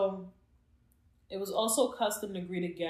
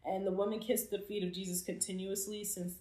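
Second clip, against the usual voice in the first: ordinary delivery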